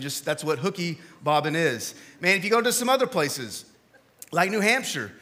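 usual speaking voice

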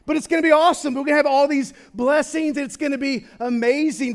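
loud, high, fervent sermon build; full-force preaching. Acted. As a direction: preach it out, lifting the crowd. Excited preaching about heaven's joys.

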